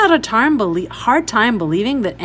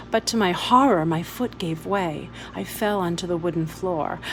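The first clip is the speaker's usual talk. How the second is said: ordinary delivery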